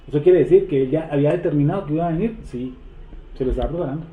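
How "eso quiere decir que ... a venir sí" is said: normal talking voice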